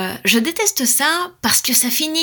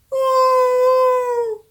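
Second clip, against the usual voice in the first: high, louder howl; wolf howl; 12 semitones higher, 4 dB louder than usual. A narrator's reading. Storyteller howling as the wolf wakes the fairy.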